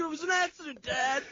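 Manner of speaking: put-on high, small kid voice pleading; whiny kid. Scolded kid explaining to dad, high mock whine.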